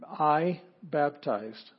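I baptized drawn out slowly; slow, careful. Shown as a demonstration. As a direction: spell it out slowly for students. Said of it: "This means I baptize".

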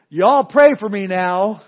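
put-on slow, loud, drawn-out Southern child's plea, with a laugh; southern accent. Little boy carried out, pleading with the congregation in a Southern accent. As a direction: cry out in a loud Southern drawl. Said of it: "crying out in this charming southern accent".

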